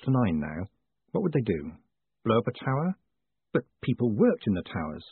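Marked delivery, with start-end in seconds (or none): none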